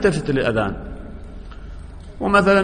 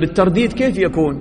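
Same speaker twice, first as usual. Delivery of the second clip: ordinary talk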